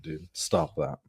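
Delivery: ordinary talk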